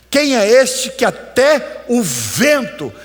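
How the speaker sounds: voz alta e aguda, martelando as palavras; pregação inflamada. Real, not acted. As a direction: pregue num crescendo a plenos pulmões. (pregação exaltada, em brado)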